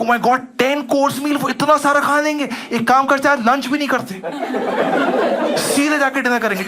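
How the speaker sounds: gleeful greedy plan to skip lunch; excited planning. Retold or imitated. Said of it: "I got excited".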